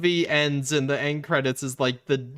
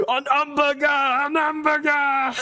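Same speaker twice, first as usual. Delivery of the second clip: put-on high, loud, slow French-accented shout; hammed-up French panic; panicked French accent; 12 semitones higher, 5 dB louder than usual. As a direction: scream An hamburger in a French accent. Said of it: "And he's like".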